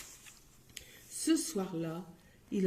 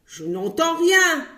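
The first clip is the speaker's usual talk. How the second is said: much higher, loud yell; 8 semitones higher, 9 dB louder than usual. Acted. Grocer yelling he hears nothing. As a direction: holler it back. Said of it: "hurlait l'épicier".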